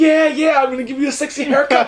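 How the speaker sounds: high, rushed excited patter; hyped-up hairdresser. Acted. Hairdresser promises client a sexy haircut, hyped. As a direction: sell the makeover in a high, eager voice.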